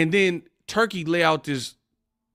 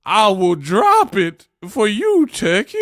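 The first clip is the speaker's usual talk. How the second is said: mock devotion; 4 semitones higher, 6 dB louder than usual. Mock sucking up to Turkey.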